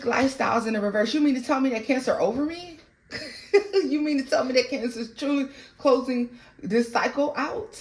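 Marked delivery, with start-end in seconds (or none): 1.1-7.8 incredulous, raised pitch, voicing the ex; hammed-up disbelief from the jilted one; mock outrage. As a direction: act out the ex who can't believe it.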